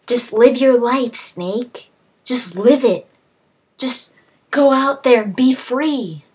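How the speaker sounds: a mock emotional send-off; earnest plea. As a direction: push him to go live his life. Naomi urging Snake to live.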